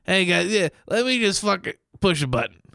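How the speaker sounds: high, bright canned cutout voice; animatronic voice. Pretend. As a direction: sound like a talking cardboard cutout. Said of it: "it'd be like Greg".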